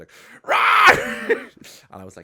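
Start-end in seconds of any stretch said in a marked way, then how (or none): 0.4-1.4 high, loud screeching roar; hammed-up beast scream; monster roar. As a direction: let rip a sudden mock monster roar. Monster roar from guy's perspective.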